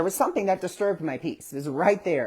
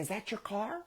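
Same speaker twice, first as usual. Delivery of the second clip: put-on high, soft question to a toddler; talking to small child; 5 semitones higher, 10 dB quieter than usual. Adult asks child about car, child-directed soft voice. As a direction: ask gently, pitched up and hushed.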